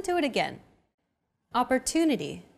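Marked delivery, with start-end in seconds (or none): 1.5-2.5 Opportunity with a longer, stressed "tu". Shown as a demonstration. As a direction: lean on the third syllable of Opportunity. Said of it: "pronounced longer than all other syllables".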